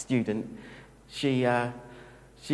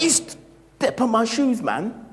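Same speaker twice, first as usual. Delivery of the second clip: higher, louder, slow confrontational snarl; mock aggressive tough guy; 10 semitones higher, 6 dB louder, slower than usual. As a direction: get up in their face about the shoes. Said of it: "I know plenty of people like".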